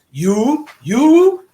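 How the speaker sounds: high, loud mock accusation; single out the culprit, drawn out and high; imitated coach calling out. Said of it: "Jan looks at me and goes".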